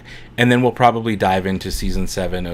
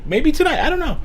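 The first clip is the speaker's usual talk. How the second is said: squeaky high teasing. Acted. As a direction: jump way up into a squeak. Playful teasing reversal.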